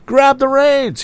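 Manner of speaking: very high urgent cry; cried out. Acted. Tom crying out to the young lady, high urgent cry. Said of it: "he cried again".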